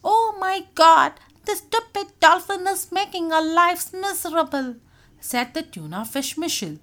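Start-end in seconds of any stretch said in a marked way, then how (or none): 0.0-4.8 high, loud, exasperated wail; complaining. A narrator's reading. Tuna moaning to fellow fish about Doobie, complaining. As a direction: stretch out the grievance. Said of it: "fellow fish would often complain".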